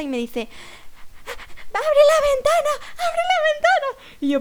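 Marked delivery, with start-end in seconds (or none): 1.7-4.2 high, loud mock panic from a stranger on the bus; hammed-up high yell of a panicking woman; frantic woman yelling. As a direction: squeal it like a desperate passenger begging for air. Panicked woman demanding open window.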